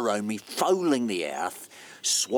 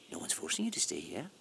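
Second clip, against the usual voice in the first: ordinary talk, nothing marked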